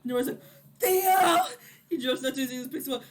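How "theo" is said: high, loud cry of a name; alarmed call. Acted. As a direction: call out in mock panic. Boy calls out to collapsed Theo.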